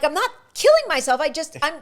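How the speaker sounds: high, loud, quick retort; exasperated protest. Retold or imitated. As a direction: protest at a friend's needless worry, retold. Retold protest to worried friend.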